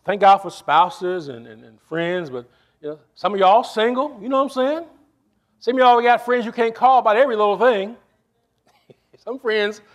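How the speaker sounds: ordinary talk, nothing marked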